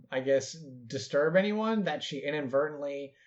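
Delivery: ordinary delivery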